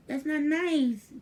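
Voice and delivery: playful mock scolding. Nana to baby who wee-weed on her, playful mock scold. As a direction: wag a finger at him in fun.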